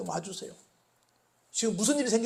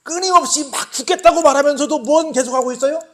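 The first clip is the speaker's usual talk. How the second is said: loud, higher preaching, slowing at the close; fiery preaching; 7 semitones higher, 12 dB louder than usual. Acted. Preacher driving home the enemy point, loud and fervent. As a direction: preach it hard to the congregation.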